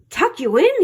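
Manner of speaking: high, indignant question; pirate bellow. A narrator's reading. Captain asked to tuck the boy in, bellowing. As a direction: bellow it in disbelief. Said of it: "he bellowed".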